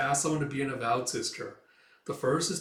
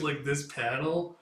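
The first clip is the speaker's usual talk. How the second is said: normal talking voice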